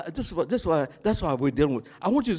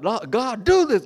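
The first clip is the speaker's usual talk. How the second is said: high, loud pleading to God; pleading request; 7 semitones higher, 6 dB louder than usual. Acted. Churchgoer begging God to punish others, high and pleading. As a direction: beg God to act against them. Preacher voices believer asking God.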